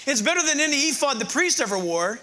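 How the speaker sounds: usual speaking voice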